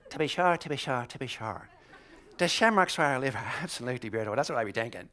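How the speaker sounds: put-on Irish brogue; Irishman impression. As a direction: play a caricature Irishman. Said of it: "you're an Irishman".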